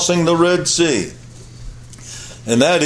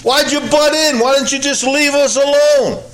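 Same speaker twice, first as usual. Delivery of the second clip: much higher, whining accusation; accusing complaint; 8 semitones higher than usual. Retold or imitated. Israelites blaming Moses, high whining accusation. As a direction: throw the blame at him, pitched up high. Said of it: "That's their argument".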